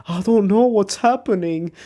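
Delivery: feigning innocence to the other players; mock innocent. Fake innocence retold.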